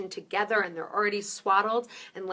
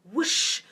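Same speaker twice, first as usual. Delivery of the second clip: breathy whoosh, pitched up; whoosh sound; 7 semitones higher, 4 dB louder than usual. Shown as a demonstration. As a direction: imitate the heartbeat whoosh. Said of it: "It's this whoosh".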